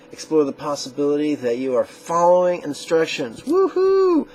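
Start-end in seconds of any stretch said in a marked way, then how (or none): 3.4-4.3 high, loud woohoo; sudden high-pitched celebratory whoop; playful cheer. Pretend. Cheers viewers who followed instructions.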